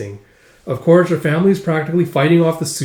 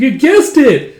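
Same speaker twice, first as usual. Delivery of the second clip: high, loud cheer of delight; excited cheer; 10 semitones higher, 7 dB louder, slower than usual. Acted. Recapper voicing a delighted riddle-giver, cheering high and loud. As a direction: cheer like you just won.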